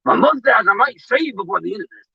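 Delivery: wrestler promo voice; Hulk Hogan voice. Pretend. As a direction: talk like a pro wrestler. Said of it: "I'm done with this accent".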